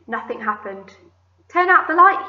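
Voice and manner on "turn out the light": raised, high call across the dark room. Acted. Centipede calling to the glowworm, loud raised call. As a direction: call it out louder and higher. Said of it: "he called, raising his voice".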